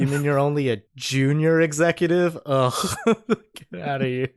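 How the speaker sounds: posh-sounding snooty disdain. Retold or imitated. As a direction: look down your nose. Voicing snobby cat.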